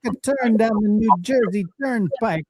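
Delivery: a hint of a Jersey storyteller; New Jersey accent. Co-host teasing with a lightly done New Jersey accent. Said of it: "they don't talk like that".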